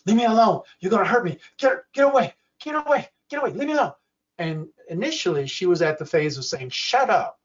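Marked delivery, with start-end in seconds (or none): 0.0-3.9 high, agitated cries to be left alone; imitated alarm, pitched way up; panicked plea. Veteran reliving trauma while watching TV. 6.7-7.4 mildly acted annoyance; impatient snap. Wife's early impatient reaction retold.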